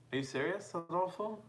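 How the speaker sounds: can't believe his luck; amazed. Real, not acted. Amazed the viewer is from Guadalajara.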